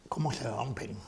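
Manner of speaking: soft, breathy whisper asking how; hushed rhetorical whisper to the congregation; whispered question. Real, not acted. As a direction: drop to a hushed whisper, as if to yourself.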